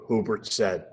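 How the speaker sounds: ordinary delivery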